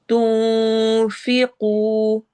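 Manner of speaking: slow, drawn-out tajweed chant; Quran recitation. Shown as a demonstration. Teacher recites verse for students, slow tajweed chant. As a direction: recite it slowly, stretching each sound. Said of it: "नून की आवाज़ को हमने छुपाना है".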